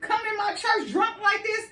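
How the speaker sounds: put-on the priest chiding a woman he thinks is drunk; scolding priest. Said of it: "And he was like".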